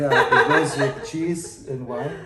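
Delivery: real laugh through the words; amused, laughing as the line comes out. Real, not acted. Laughing after a joke about trying it.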